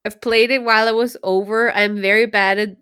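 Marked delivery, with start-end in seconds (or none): none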